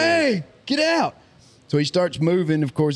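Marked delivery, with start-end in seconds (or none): 0.0-1.1 much higher, drawn-out mock holler; retold calling out. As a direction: call out a warning, stretched and high, without real volume.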